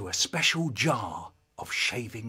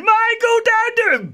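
frantic little voice; 13 dB louder than usual. Acted. Conscience pleading with boy to stop, frantic. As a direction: shriek the warning, stretching it. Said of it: "the little voice said".

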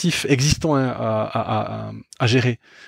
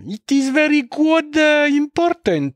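put-on English in a thick Russian accent, much higher and slow; much slower than usual. Recalling Alex the mad scientist's English, in a Russian accent. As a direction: imitate a Russian colleague's English, high and drawn out. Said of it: "vraiment avec l'accent russe".

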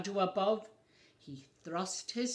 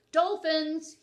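high, loud bellow; bellowing walrus; 7 semitones higher, 8 dB louder than usual. A narrator's reading. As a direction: call the dolphins across the ice. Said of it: "he bellowed".

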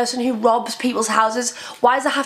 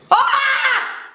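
frustrated shout; 11 semitones higher than usual. Real, not acted. Frustrated outburst.

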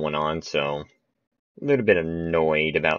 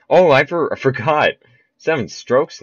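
surprised; 8 semitones higher, 6 dB louder than usual. Real, not acted. Realizes stroke rule.

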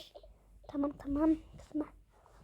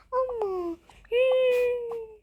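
mock crying; 7 semitones higher, 3 dB louder, much slower than usual. Unloved girl character cries.

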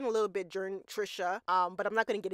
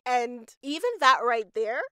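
ordinary talk, nothing marked

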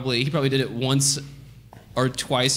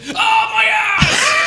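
long, high, loud mock holler; 6 dB louder, much slower than usual. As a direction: blurt it out in a drawn-out holler. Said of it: "this guy's, like, hollering".

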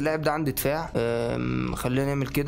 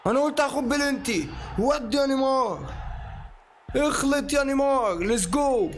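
high, drawn-out cheer for Neymar; excited cheering; 8 semitones higher than usual. Real, not acted. Gamer hyping Neymar in FIFA, a high excited cheer. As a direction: egg the player on, thrilled.

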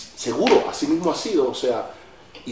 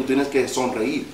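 ordinary talk, nothing marked

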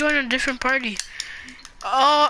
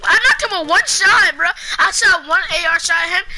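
high, loud burst of excitement; excited boasting; 5 semitones higher, 5 dB louder, faster than usual. Real, not acted. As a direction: brag loudly about your shot. Boasts of a one-shot knock.

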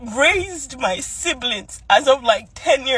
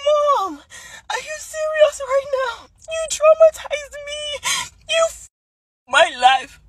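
very high, crying wail of protest; tearful confrontation; 13 semitones higher than usual. Retold or imitated. Daughter confronting her mother over childhood trauma, in a high tearful wail. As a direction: wail it at mom, voice pitched way up.

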